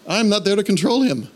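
high, fretful complaint; worried about losing control; nervous. Retold or imitated. Pastor retelling his vision, nervous and high. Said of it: "And I got nervous".

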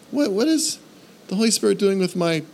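high, worried rush of questions; suddenly anxious wondering; nervous. Retold or imitated. Pastor worrying what the Spirit does with his day, nervous. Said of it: "And I got nervous".

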